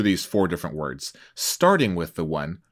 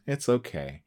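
softer, unimpressed; lukewarm; 3 semitones higher, 7 dB quieter than usual. Shown as a demonstration. Host showing a lukewarm verdict, softer. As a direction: shrug it off as merely okay. Contrasting strengths of praise.

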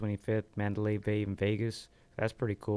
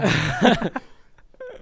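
long high-pitched laughter, rising; 12 semitones higher, 10 dB louder than usual. Real, not acted. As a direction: crack up at the memes. Laughs at memes remark.

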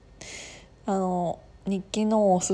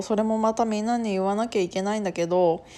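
ordinary talk

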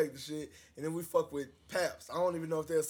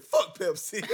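louder and higher, laughing; laughing punchline; 7 semitones higher, 9 dB louder, slower than usual. Real, not acted. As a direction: deliver the punchline cracking up.

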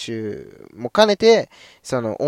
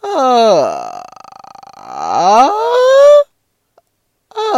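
ah climbing from high to very high and loud; rising to high note; 11 semitones higher, 10 dB louder, much slower than usual. Shown as a demonstration. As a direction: push the ah up into the top of the range. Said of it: "一回やってみますと".

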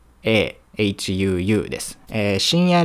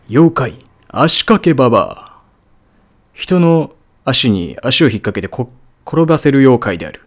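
narrator voice; 3 semitones higher, 6 dB louder than usual. Shown as a demonstration. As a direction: announce it like a TV narrator. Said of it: "ナレーター風に".

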